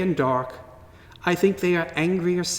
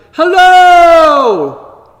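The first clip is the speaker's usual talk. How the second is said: big call from a mountaintop, much higher and louder; shouting hello; 12 semitones higher, 18 dB louder than usual. Retold or imitated. Child on a mountaintop shouting hello to hear the echo. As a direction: shout it out to the valley below. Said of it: "and I shouted".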